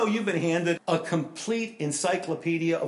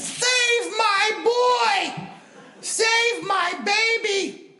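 high, slow, desperate wail of a mother; frantic mother's scream; 5 dB louder, much slower than usual. Retold or imitated. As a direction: scream it like a panicked mother in the stands.

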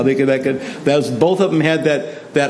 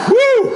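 very high-pitched "woo"; astonished whoop; 4 dB louder than usual. Real, not acted. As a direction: let out a short high whoop of shock. Reacts to the shocking confession.

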